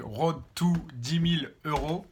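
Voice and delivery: French speaker's clumsy English accent; bad English accent. Real, not acted. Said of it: "j'ai un accent anglais assez dégueulasse".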